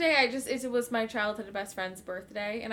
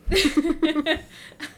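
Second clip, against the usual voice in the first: loud high burst of laughter; laughing hard at the joke; 6 semitones higher, 9 dB louder than usual. Real, not acted. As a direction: let out a big laugh.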